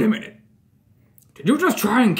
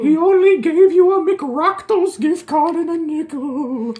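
high, slow, drawn-out figment voice; slow wailing incitement; eerie goading; 6 semitones higher, slower than usual. Acted. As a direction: goad them like a haunting apparition. Figment goads Bonnie against Fred.

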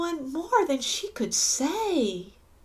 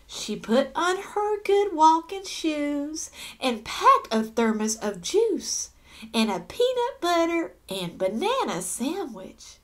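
ordinary delivery, nothing marked

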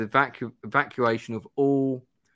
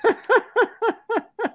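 high-pitched laugh in short bursts; high giggle; 4 dB louder than usual. Real, not acted. Streamer put off by giggling listener, high laugh.

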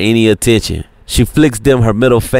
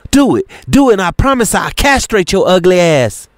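taunting dare; 9 semitones higher than usual. Acted. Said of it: "pulls out her switchblade".

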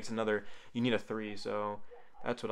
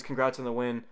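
ordinary talk, nothing marked

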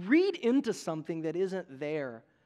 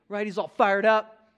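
normal talking voice

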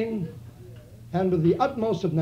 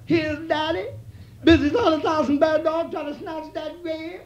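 high, breathy-free coy purr, much higher; coy woman's voice; 9 semitones higher than usual. Acted. Woman coaxing her daddy, high coy voice. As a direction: play the sly woman sweet-talking her man. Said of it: "She says".

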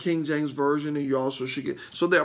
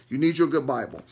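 usual speaking voice

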